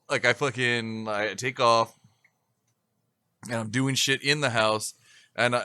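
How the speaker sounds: normal talking voice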